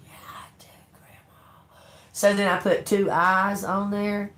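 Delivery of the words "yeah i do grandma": imitating the grandson's breathless whisper; tiny awed whisper. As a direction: hush it like a wide-eyed little boy. Grandson answering in a hush.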